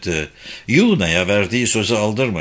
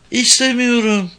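put-on high young girl's voice; reluctant girl; 12 semitones higher than usual. A narrator's reading. Girl refuses to go inside, high young voice. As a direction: refuse in a high girlish pitch.

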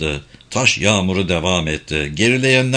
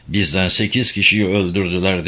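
usual speaking voice

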